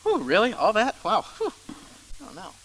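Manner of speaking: a tired listener groaning at the ask; exhausted reluctance. Retold or imitated. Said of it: "does anybody else feel kind of exhausted".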